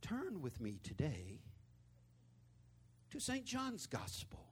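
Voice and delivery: slow, soft mock pulpit cadence; measured, put-on sermon voice with long pauses. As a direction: parody a hushed preacher, drawing out each phrase. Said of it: "they all go into this fake voice".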